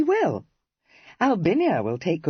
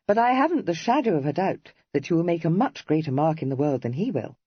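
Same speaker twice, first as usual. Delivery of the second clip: usual speaking voice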